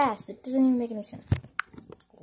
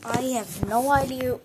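ordinary talk

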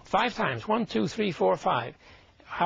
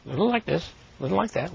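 normal talking voice

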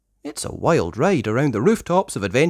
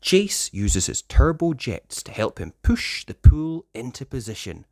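usual speaking voice

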